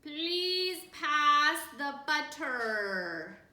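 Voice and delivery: booming, slow, every word stretched out; loud deliberate father at dinner. A narrator's reading. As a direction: announce it to the whole table, slowly. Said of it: "announced in a very loud voice".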